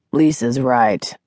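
slow, solemn man's voice. A narrator's reading. Doctor backing his wife's judgement, solemnly. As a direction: weigh the words gravely, unhurried. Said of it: "the man said solemnly".

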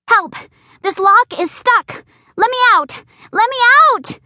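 high, loud panicked screaming; screaming for help. Acted. Dog locked in bathroom screaming at the door for rescue, loud panicked scream. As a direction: yell it, trapped behind a stuck door. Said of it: "I scream and bang on the door".

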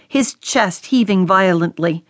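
ordinary delivery

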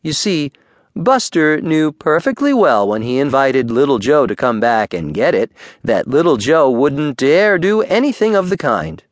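ordinary delivery

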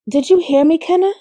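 high, rising question; checking he's listening. A narrator's reading. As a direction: press for a reply, voice climbing. Woman pressing silent boyfriend on phone.

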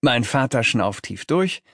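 usual speaking voice

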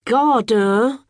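"Garder" with a heavy thudding d; thumped d. A narrator's reading. Girl inventing a word, thumping its "d" like a drum. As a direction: hit the d in "Garder" like a bass drum. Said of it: "thumping the "d".

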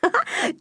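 short, high acted giggle; children's laugh. A narrator's reading. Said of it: "The children laughed".

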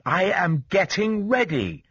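slow, high, separated words; laboured, deliberate reply; careful pronunciation. A narrator's reading. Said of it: "forcing himself to pronounce carefully".

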